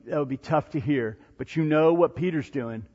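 ordinary delivery

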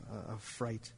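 ordinary talk, nothing marked

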